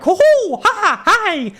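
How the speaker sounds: high, loud bubbly bird folk cheer; mock the goofy cheerful Tengu; cheery Tengu voice. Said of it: "All the Tengu voice acting".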